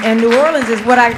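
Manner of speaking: usual speaking voice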